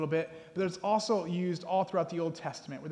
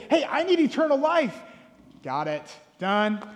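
higher and louder, rising into an excited cry; eager preacher; 8 semitones higher, 9 dB louder than usual. Retold or imitated. Preacher mocking his own eager evangelism, excited. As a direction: climb in excitement to the big line. Eager pastor's easy answer.

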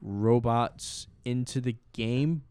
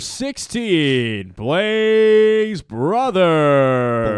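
slow, much higher, louder ballpark call; PA announcer impression; 5 semitones higher, 10 dB louder, much slower than usual. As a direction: drag the name out like the stadium PA. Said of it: "That was pretty good".

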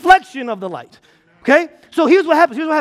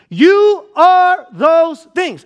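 You are those things drawn out slow, higher and louder; stress on YOU ARE; 5 semitones higher, 5 dB louder, much slower than usual. Real, not acted. Preacher insisting to the congregation, slow and emphatic. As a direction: lean hard into You are, slowly.

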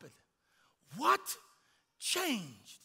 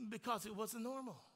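ordinary delivery

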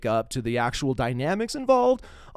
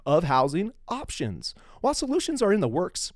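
measured, formal read-aloud of a prepared text; formal statement read; 4 semitones higher than usual. Acted. Podcast host reads a charity statement, formal read.

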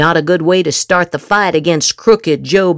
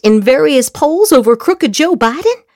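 mock alarm; 10 semitones higher than usual. Mock alarm at good poll news.

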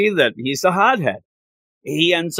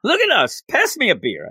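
mock drunk guard; 7 semitones higher than usual. Mocking drunk townsfolk.

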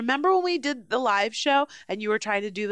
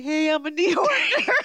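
higher, louder mocking New York accent; mock New Yorker; 5 semitones higher, 4 dB louder than usual. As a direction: parody a bad New York accent, laughing. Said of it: "you are doing a New Yorker".

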